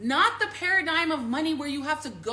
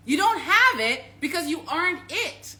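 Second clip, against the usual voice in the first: raised, emphatic punchline; loud declaration; 7 semitones higher, 7 dB louder than usual. Real, not acted. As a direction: hit it hard and high.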